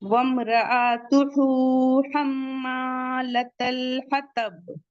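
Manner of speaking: the phrase recited slowly, held; slow recitation. Shown as a demonstration. Teacher recites the whole phrase slowly. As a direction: draw out हम्मालतल with a nasal hum. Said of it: "कुबरा होगा".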